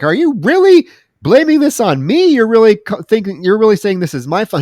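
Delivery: high-pitched retold indignation; mock indignant. Assistant challenging her boss over blame, mock indignant.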